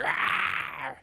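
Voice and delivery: unvoiced breathy mock roar; huge fiery dragon roar. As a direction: roar like a beast, breathy and hushed. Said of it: "He comes out and he's like".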